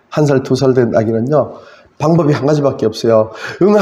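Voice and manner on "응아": imitated baby cry. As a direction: imitate a wailing baby in one long cry. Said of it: "하면서 우는 것밖에 없어요". (아기 울음 흉내)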